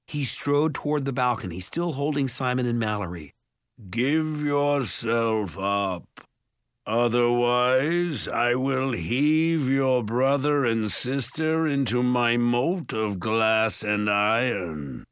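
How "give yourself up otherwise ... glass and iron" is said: slow, booming ogre threat; Mulgarath. A narrator's reading. Ogre threatening to heave brother and sister, booming. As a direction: boom each word out, long and menacing. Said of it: "Mulgarath's voice boomed".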